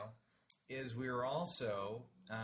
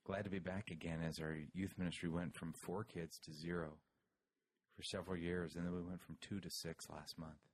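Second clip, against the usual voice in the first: hushed NPR voice; 6 semitones lower than usual. Pretend. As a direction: read the letter in a mock-solemn NPR hush. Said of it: "N P R".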